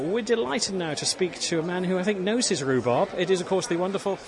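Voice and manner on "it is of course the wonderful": ordinary talk, nothing marked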